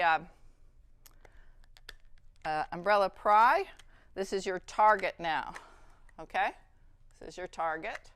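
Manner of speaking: ordinary talk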